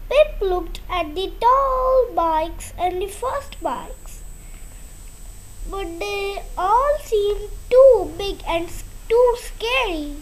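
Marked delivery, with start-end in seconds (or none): none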